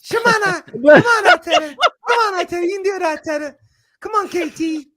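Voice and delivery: high-pitched mocking squeal; Igal. Teasing the co-host over noisy match cheering, high-pitched impression. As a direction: mimic a noisy fan in a piercingly high voice. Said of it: "you were high-pitched the whole day".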